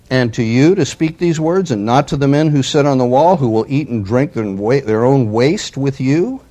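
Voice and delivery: loud, declaiming the envoy's taunt; forceful scripture reading. Acted. Assyrian envoy taunting Jerusalem, read out loud and forceful. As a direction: proclaim it like a herald's challenge.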